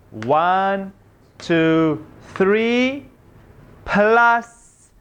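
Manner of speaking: put-on slow, sing-song counting for little kids; sweet teacher voice; kindergarten teacher voice. Math lesson for young children.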